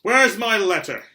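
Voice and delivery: loud, slow, high demand; boy shouting for his letter; shouted demand. Acted. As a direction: yell it out, insisting.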